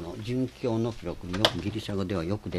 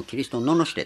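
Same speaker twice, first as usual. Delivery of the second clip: mock stern command; 5 semitones higher, 9 dB louder than usual. Governor commands reviling Christ.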